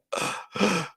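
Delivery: high sharp winded gasp; mock breathless gasp. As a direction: gasp as if the wind was knocked out of you. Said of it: "it took my breath away".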